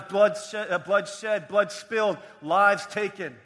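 emphatic sermon delivery; preaching. Real, not acted. Preaches at raised pitch and volume.

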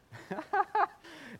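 high-pitched chuckle; short run of high laughter. Real, not acted. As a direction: laugh at your own story. Laughs at own story.

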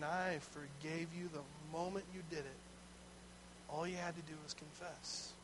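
soft, tender reassurance; gentle voice of God. Retold or imitated. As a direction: quietly assure them they're forgiven. God speaking gently to sinner.

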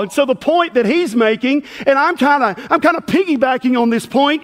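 normal talking voice

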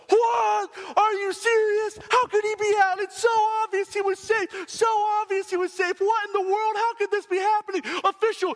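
outraged fan in the stands shouting at the official; mock outraged fan. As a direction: play the furious fan in section one oh eight, way up high. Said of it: "I'm being a little facetious here".

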